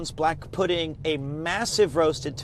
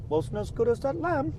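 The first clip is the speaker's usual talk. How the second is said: much higher mock voice of a grumbling Paul; imitating Paul; 10 semitones higher, faster than usual. Teasing Paul who compares every meal with the lamb, mock. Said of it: "Paul goes".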